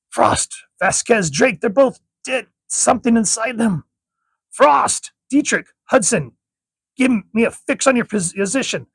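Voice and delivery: high, loud alarmed yelling; a soldier calling out over the radio in panic; panicked shouting. Acted. Soldier finds bodies, panics.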